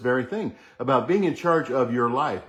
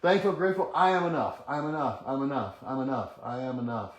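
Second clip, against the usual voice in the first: I'm enough repeated, growing ever softer; fading affirmation; 6 dB quieter than usual. Shown as a demonstration. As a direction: let the mantra sink to a murmur. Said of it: "Do it with me".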